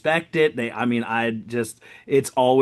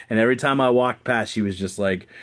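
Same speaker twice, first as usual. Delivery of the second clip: ordinary delivery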